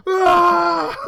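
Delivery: high, loud comic shriek; mock terrified scream. Friends finding a severed head in the car, mock scream. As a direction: scream like a carful of shocked passengers.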